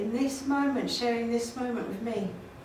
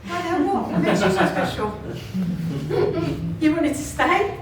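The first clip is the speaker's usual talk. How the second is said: laughing challenge; 3 semitones higher, 4 dB louder than usual. Acted. Laughing mock challenge to guest.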